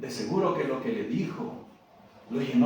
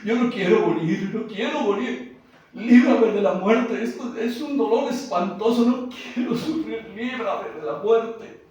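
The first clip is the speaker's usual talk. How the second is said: somewhat higher pleading voice; mild weeping plea; 9 semitones higher, 5 dB louder than usual. Acted. Jesus prostrate again, mildly higher weeping plea. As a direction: beg the Father, half crying. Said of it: "volvió a llorar".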